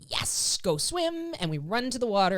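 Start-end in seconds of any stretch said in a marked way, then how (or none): 0.1-1.3 high, eager go swim; upbeat cue to the dog; bright release cue. Shown as a demonstration. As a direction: cheerfully release him to the water.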